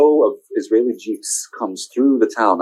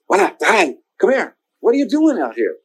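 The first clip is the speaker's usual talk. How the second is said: higher urgent call from the doorway; higher shopkeeper voice calling out; shopkeeper calling over; 8 semitones higher than usual. Retold or imitated. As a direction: beckon the kid inside, higher. Shopkeeper calls him in.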